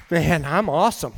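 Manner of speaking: low, soft, comic swagger; mock self-congratulation. Climber safely down admiring himself, comically smug. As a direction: marvel smugly at yourself under your breath.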